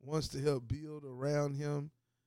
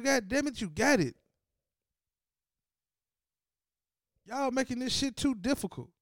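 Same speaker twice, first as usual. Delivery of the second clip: much higher, much louder angry yelling; furious yelling rant; 10 semitones higher, 7 dB louder than usual. Real, not acted. Host yelling at the team to just pay him, furious. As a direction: shout it down like it's obvious.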